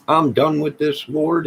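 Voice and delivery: loud, high burst of frustration at God; exasperated cry to the Lord; fed up outcry. Real, not acted. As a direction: throw it up to the sky, done with it all.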